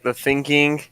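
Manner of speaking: ordinary talk, nothing marked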